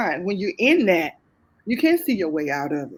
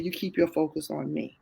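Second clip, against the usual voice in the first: a quieter, hushed take on God speaking; gentle urging, softer than her own talk; 2 semitones lower, 5 dB quieter than usual. Retold or imitated. God's gentle voice retold.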